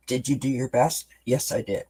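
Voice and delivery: ordinary delivery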